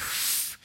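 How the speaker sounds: long imitated puff of smoke; blowing smoke. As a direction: blow out air like a smoker exhaling. Imitates father blowing smoke in ear.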